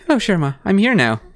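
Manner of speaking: normal talking voice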